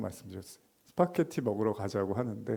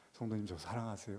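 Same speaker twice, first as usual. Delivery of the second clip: soft pleading question; 3 semitones lower, 12 dB quieter than usual. Acted. Pastor asking softly.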